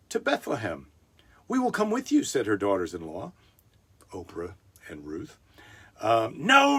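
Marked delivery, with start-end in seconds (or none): 1.5-2.2 put-on high, eager young women; lightly voiced daughters. Daughters-in-law quoted in story. 6.4-6.8 put-on high, loud old-woman refusal; old Naomi's no.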